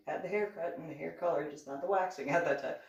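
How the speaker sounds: ordinary talk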